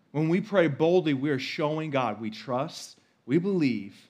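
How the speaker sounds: normal talking voice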